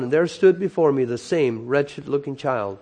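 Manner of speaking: ordinary delivery, nothing marked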